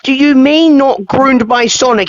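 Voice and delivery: indignant anger on the listener's behalf; outraged. Real, not acted. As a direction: demand it in disbelief. Outraged at the groomer's denial.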